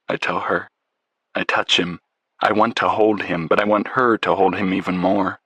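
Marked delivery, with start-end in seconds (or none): none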